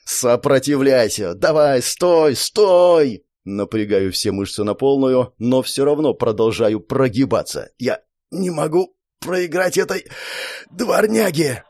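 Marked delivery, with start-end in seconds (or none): 0.1-3.3 slow, building to a louder cry; straining shout. Acted. Hero fights the spell. 7.8-11.6 high, pained defiance through teeth; strained defiant cry. Acted. Hero refuses to lose to mongrel.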